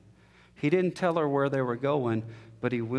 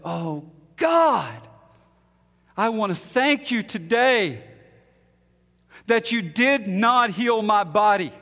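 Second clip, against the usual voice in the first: much higher and louder, slow and fervent; tearful prayer; 8 semitones higher, 7 dB louder, slower than usual. Retold or imitated. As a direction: cry out thanks to God through tears. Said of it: "she started crying".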